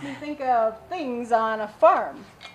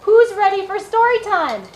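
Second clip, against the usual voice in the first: rally the little ones; excited call; 9 semitones higher, 5 dB louder than usual. Real, not acted. Children's librarian calling out.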